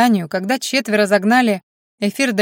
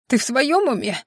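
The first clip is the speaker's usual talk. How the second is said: hoarse after coughing; хрипло; 3 semitones higher than usual. A narrator's reading. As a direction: rasp it out, throat still sore. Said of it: "хрипло отозвалась принцесса". (хриплым голосом после кашля)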